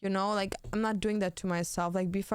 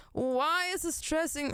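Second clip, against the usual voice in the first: high slow whine; 7 semitones higher than usual. Acted.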